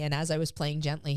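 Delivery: ordinary talk, nothing marked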